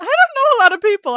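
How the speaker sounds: playful mocking repeat; teasing echo. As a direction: throw the line back teasingly. Repeating friend's line back.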